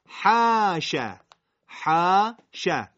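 حاشه with the حا held long, loud and slow; Arabic long vowel. Shown as a demonstration. Teacher repeating حاشه with a stretched long vowel. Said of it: "صدای کشیده با مقدار کشش".

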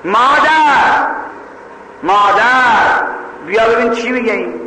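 long, drawn-out high calls; calling out in alarm. Acted. Girl calling her mother about the intruder, acted shout. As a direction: yell for mother, stretching each call. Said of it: "دختره داد زد".

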